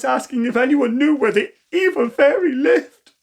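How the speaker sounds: wobbly voice. A narrator's reading. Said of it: "She spoke in a wobbly voice".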